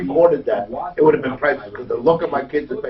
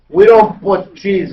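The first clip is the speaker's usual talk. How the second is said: slow, louder imitated Italian accent; Italian waitress refusing the kids' order, slow loud Italian accent; 5 semitones higher, 9 dB louder, slower than usual. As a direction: mimic the Italian waitress, firm and drawn out, in her Italian accent. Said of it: "The way she said it".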